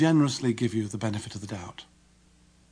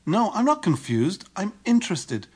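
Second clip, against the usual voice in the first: raised pitch, over-stressed denial; incongruent insistence; 7 semitones higher than usual. Retold or imitated. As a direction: insist with a tone that contradicts the words. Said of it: "used a tone of voice that was incongruent".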